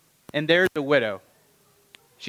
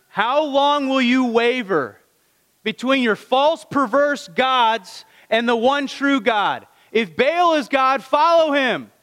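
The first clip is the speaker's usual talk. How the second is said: Elijah's challenge; 6 semitones higher, slower than usual. Retold or imitated. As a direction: thunder it slowly at the crowd. Elijah confronts 850 prophets and people.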